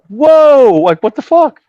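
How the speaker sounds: loud, high cry of alarm; startled protest. Retold or imitated. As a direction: cry out as if suddenly grabbed. Acts shock at being grabbed.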